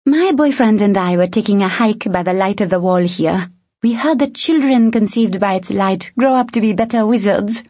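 cheerful made-up story; glib thief voice. A narrator's reading. Tern spins a lie.

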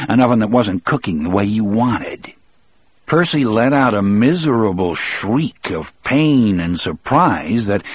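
plain reading voice

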